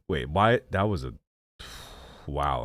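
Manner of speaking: ordinary talk, nothing marked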